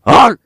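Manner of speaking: short, high, loud bark; mock dog bark. Retelling how a man barked at them, imitated bark. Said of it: "he barks at me".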